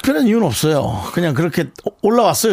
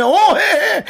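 high eager agreeing; quick keen yes yes; 4 dB louder than usual. Retold or imitated. Eager yes to insurance pitch.

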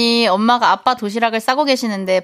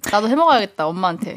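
usual speaking voice

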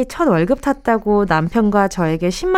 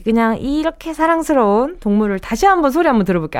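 ordinary talk

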